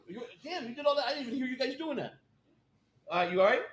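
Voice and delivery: soft, quick aside away from the call; turning away to talk to someone nearby; aside off the phone. Real, not acted.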